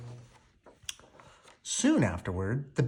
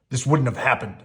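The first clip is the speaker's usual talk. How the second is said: sulking; 4 dB louder than usual. A narrator's reading. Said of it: "sulked the beast".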